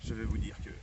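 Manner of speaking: ordinary delivery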